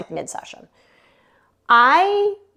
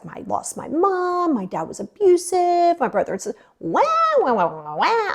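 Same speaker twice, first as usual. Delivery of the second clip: mock victim whining; 9 semitones higher than usual. Client venting to therapist, mock whining. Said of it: "you talk about victim of circumstance".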